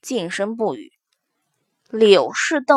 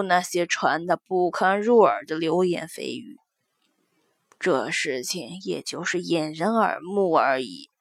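grandmother explaining with quiet gravity; old woman voice; 6 semitones lower, 7 dB quieter than usual. Acted. Elderly mother explains the fake burial.

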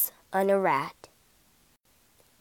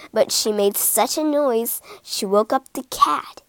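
ordinary delivery, nothing marked

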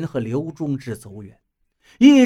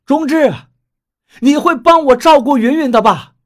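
loud, higher pleading call; shouting a last plea to his leaving friend; 8 semitones higher, 7 dB louder than usual. Acted. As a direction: call after a friend walking away. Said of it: "赵建国忽然喊道".

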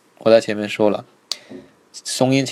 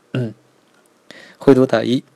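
ordinary delivery

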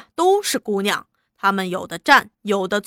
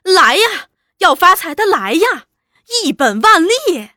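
high, loud barker's cry; 叫卖吆喝; 7 semitones higher, 6 dB louder than usual. A narrator's reading. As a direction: call out to the crowd like a circus clown touting a casino. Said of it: "他喊着". (高声吆喝揽客)

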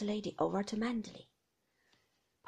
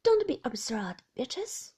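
hurried; 3 semitones higher, 7 dB louder than usual. Acted. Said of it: "I said hurriedly".

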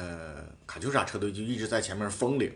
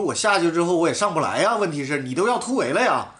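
higher, louder insistent explaining; heated self-defence; 6 semitones higher, 4 dB louder than usual. Retold or imitated. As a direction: press the point to the manager. Retold defence to team manager. (向车队经理激动地辩解)

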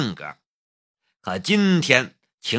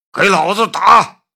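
loud, high shouted command; roaring order; 7 semitones higher, 7 dB louder than usual. A narrator's reading. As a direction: bellow the order to attack. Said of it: "陈传虎指着病床上的夏雷吼道".

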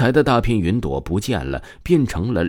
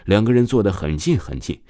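ordinary delivery, nothing marked

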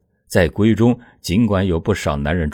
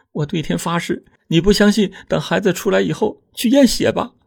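higher woman's voice, earnest oath; pleading young wife; 11 semitones higher than usual. A narrator's reading. Wife swears to disbelieving husband, high earnest voice. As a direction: swear it desperately to him.

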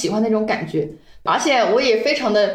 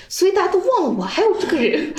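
laughing; 8 semitones higher than usual. Retold or imitated. Mock self-doubt, laughing.

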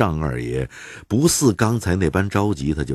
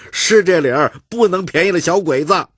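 crowd heckling; 13 semitones higher, 6 dB louder than usual. Acted. Bystanders chime in.

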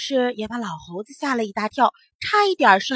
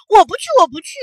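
put-on loud, high childish protest; protesting refusal; 8 semitones higher, 11 dB louder, much faster than usual. A narrator's reading. Caught boy refusing to go back, protesting shrilly. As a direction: wail your refusal.

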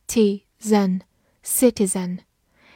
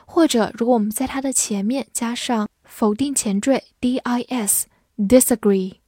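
usual speaking voice

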